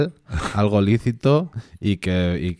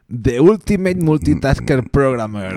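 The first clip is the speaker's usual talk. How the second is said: slow, higher movie-trailer voice; trailer announcer; 5 semitones higher, slower than usual. Pretend. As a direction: announce it like an epic title. Mock title in English.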